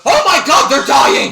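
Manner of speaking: loud, high-pitched shout of horror; panicked cry. Acted. Bandit spots his burning comrades, panicked cry.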